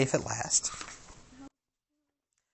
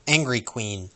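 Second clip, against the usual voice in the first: usual speaking voice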